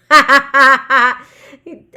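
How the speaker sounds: loud delighted laugh; real laugh out loud; burst of laughter. Real, not acted. Reading the jail cell sketch.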